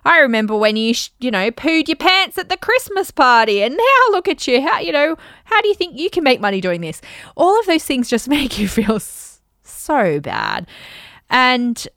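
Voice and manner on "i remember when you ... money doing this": high, loud, mocking old uncle; hammed-up mocking uncle; teasing uncle. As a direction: tease like an uncle at Christmas. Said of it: "Uncle Bob has said something like".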